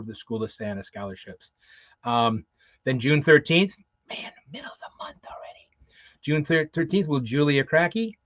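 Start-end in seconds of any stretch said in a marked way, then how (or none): 4.1-5.7 hushed, voiceless whisper; whispered aside. Real, not acted. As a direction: whisper it to yourself in disbelief. Hushed aside on how time flies.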